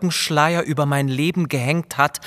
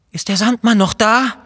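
put-on higher, louder, fearful stammer of a sick child; frightened stammer; 6 semitones higher, 5 dB louder than usual. A narrator's reading. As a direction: stammer it out, still half in terror. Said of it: "stammelte ich".

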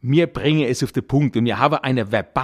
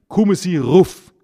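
calling down from above; 3 semitones higher, 6 dB louder than usual. Retold or imitated. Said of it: "Wenn du oben stehst und rufst".

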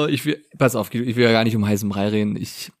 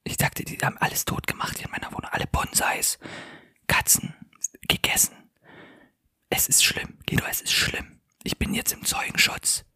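hushed report of disaster; voiceless, secretive and grave; distressed whisper; 10 dB quieter than usual. Acted. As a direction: whisper the awful news from hiding. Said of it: "Deswegen leise reden".

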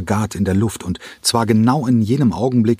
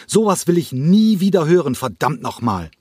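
high angry yell; furious shout; 9 semitones higher than usual. A narrator's reading. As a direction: keep raging at the room. Cranko tobt weiter. (Cranko schreit wütend und hoch durch den Saal)

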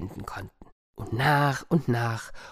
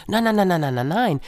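monkey protesting; 5 semitones higher, 3 dB louder, faster than usual. Acted. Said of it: "sagte der Affe".

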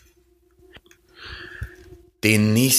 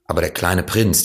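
usual speaking voice

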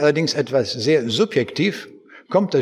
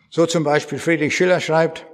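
ordinary delivery

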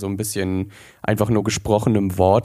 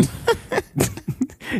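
high giggle; 5 dB quieter than usual. Real, not acted. Laughing at own pun.

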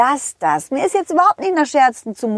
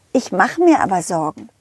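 usual speaking voice

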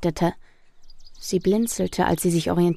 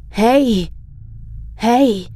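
shouting; 3 semitones higher, 4 dB louder than usual. Acted. Voice shouting at climax.

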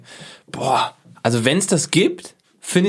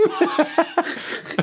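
breathy laugh. Real, not acted.

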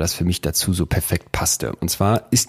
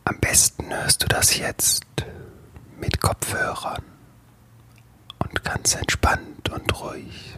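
whispering; 6 semitones higher, 5 dB quieter than usual. Acted. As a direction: whisper close to the mic. Whispers ASMR-style.

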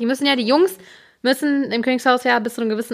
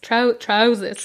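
British accent; much slower than usual. Shown as a demonstration. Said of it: "mit so einem britischen Akzent sagen".

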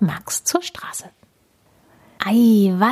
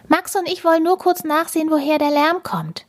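put-on high, fast girl's voice; higher hurried child voice; Emi speaking; 10 semitones higher, faster than usual. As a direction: explain it eagerly like a child. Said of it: "sagt Emi".

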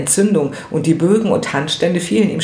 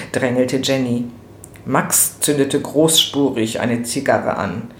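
usual speaking voice

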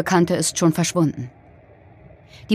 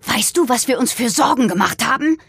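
higher-pitched, upset parental outburst; angry shouted reproach; 6 semitones higher than usual. A narrator's reading. Father to his daughter in the hallway, angry shouted reproach. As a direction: demand it in outrage, voice pitched up. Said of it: "schrie er".

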